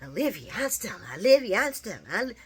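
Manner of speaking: flustered, tripping over the names; mock stuttering. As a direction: stammer through the names like a caught man. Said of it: "Hat Daddy stuttering".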